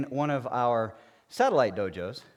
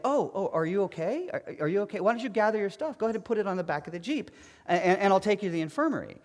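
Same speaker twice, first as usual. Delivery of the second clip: higher, fast, fussing officer voice; solicitous officer; 7 semitones higher, faster than usual. Retold or imitated. Officer in the Jeep offering a ride, fussing. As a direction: play the worried officer hurrying to help. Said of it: "And he's like".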